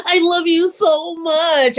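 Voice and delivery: high, slow, doting coo; gushing mom. Retold or imitated. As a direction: gush over your daughter onstage. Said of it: "'cause I'd be like".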